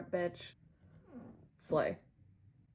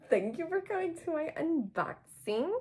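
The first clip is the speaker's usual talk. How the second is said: much higher, chirpy thank-you; bright sign-off; 11 semitones higher than usual. Real, not acted. Vlogger thanking viewers for watching, high bright thanks. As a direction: sing it out to the viewers.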